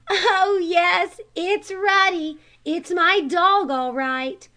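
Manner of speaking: almost crying with happiness; joyful cry. A narrator's reading. Rick telling the scoutmaster his dog is back, tearful joy. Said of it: "cried Rick".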